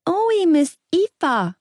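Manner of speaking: put-on high, slow toddler voice; lisping little boy. A narrator's reading. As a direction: speak like a small child.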